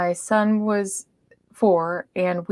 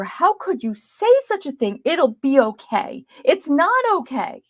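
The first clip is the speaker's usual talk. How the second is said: mock scream; 8 semitones higher than usual. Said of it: "I wanted to scream at her".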